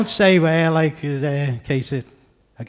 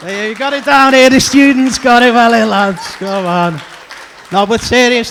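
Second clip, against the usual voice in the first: louder and higher, calling to the crowd; 7 semitones higher, 9 dB louder than usual. Real, not acted. Comic calling out to the student crowd, loud and high. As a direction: shout it over the room to the lads.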